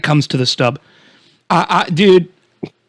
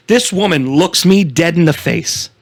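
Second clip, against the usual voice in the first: ordinary delivery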